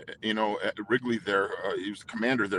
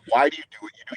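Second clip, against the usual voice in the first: pressing question; 4 semitones higher, 7 dB louder, much faster than usual. Retold or imitated. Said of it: "And I'm like".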